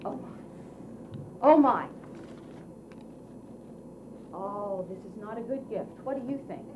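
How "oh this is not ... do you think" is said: usual speaking voice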